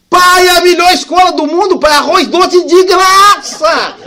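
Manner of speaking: grito alto e agudo de criança encantada; euforia infantil. Retold or imitated. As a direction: menino comemorando, bem mais alto e agudo. Filho eufórico com arroz doce. (filho empolgado conta ao pai, gritando de alegria)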